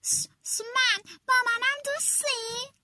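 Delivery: stammering, breathy start rising to a high ask; scared little rabbit hoping to be included; timid rabbit. Acted. Rabbit from the bushes asking the fox timidly, stammering. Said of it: "و گفت".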